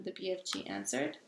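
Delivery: plain reading voice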